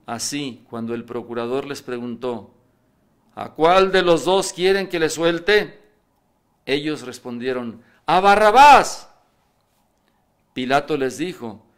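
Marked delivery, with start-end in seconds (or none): 3.4-5.8 much louder, higher question; calling to the crowd. Acted. El procurador pregunta a la multitud. 8.1-9.1 slow, much higher and louder crowd roar; crowd shout. Acted. La multitud elige a Barrabás.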